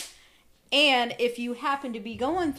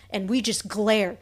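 ordinary delivery

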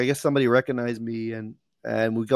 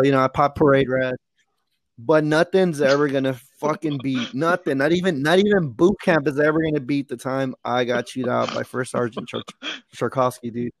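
usual speaking voice

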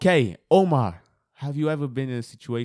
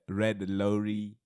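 "red lorry" slow and careful; slow tongue twister; 2 semitones lower, much slower than usual. Shown as a demonstration. Host repeats the tongue twister back, slow and careful. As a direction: sound out "red lorry" unhurriedly. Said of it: "you say it quite fast".

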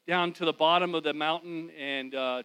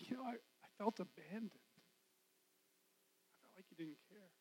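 much softer, slow, fading to breath; quiet confession; 3 semitones higher, 15 dB quieter, slower than usual. Retold or imitated. Husband finally telling his wife he felt abandoned, hushed. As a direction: admit the hurt barely above a whisper.

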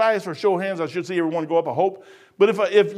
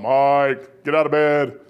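low, commanding call from above; voice of God calling; 6 semitones lower, slower than usual. Acted. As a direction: summon the sleeper in a lowered voice. Voices God calling him at night.

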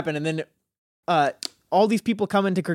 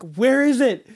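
retold frantic searching; 6 semitones higher, 4 dB louder, slower than usual. Retelling frantic search for missing package.

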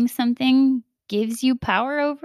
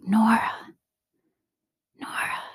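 tender; 3 semitones higher than usual. Retold or imitated. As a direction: say her name so it melts the heart. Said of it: "the way he says it just, like, melts my heart".

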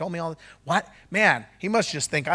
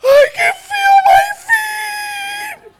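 very high, loud, slow wailing cry through laughter and tears; sobbing joyful shout; 15 dB louder, much slower than usual. Retold or imitated. Pastor crying out the healed woman's words, weeping with joy. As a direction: let it break into a joyful sob. Climax of the healing story.

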